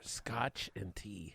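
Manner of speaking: slow soft whisper. Real, not acted. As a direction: whisper it slowly. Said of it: "why are we whispering".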